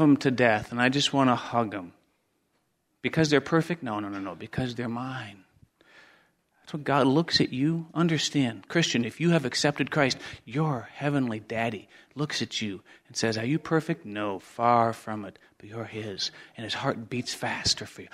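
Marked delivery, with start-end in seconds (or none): 3.8-5.4 tender hush. Real, not acted. Tender answer about his kids. 10.4-18.1 tender hush. Real, not acted. Preacher softly assures the congregation of God's love, hushed and tender.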